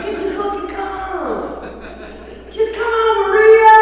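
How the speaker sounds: high, rising call building to a loud shout of the name. Retold or imitated. Eric calling Maria from the porch, building to a loud high shout.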